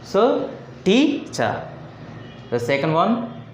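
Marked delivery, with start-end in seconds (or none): 0.8-1.7 "teacher" slowly, TEA high and stressed; stretched TEA-cher, stress up front; first-syllable stress. Shown as a demonstration. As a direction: draw out a high TEA, then drop on "-cher". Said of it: "The first syllable sounds loud".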